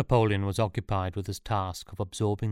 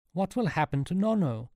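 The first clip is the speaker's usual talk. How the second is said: high-pitched, urgent demand; mild, high-pitched shout; restrained shout; 10 semitones higher than usual. A narrator's reading. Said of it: "shouted Sean".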